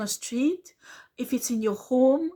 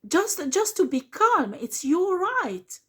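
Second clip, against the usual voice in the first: urgent high-pitched emphasis; high passionate insistence; 7 semitones higher than usual. Real, not acted. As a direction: pitch jumps way up, slowed and pleading.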